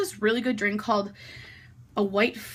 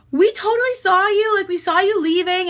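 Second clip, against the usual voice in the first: higher, louder imitation of a guy; mock excited guy; 9 semitones higher, 6 dB louder than usual. Guy telling her they saw her leave, loud mock eager voice.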